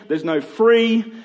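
ordinary talk

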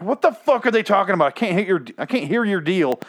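high-pitched ranting complaint; exasperated. Retold or imitated. Retold exasperated reaction to dialogue.

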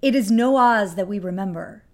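whispered, ominous trailer line; horror trailer voice. Pretend. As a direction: breathe it like a creepy teaser.

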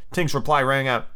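ordinary delivery, nothing marked

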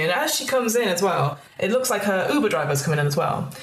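usual speaking voice

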